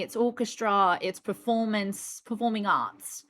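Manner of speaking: lightly put-on British accent; amateur British accent, a touch exaggerated; fake British accent. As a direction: keep up the playful British accent. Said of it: "so put upon as this".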